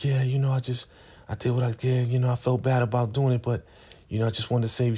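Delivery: lightly voiced, downplay it modestly; lightly voiced modesty.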